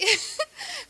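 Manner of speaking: a quick high laugh breaking the sentence; amused high giggle at her own joke. Real, not acted. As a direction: giggle before finishing the thought. Laughs mid-sentence about cooking.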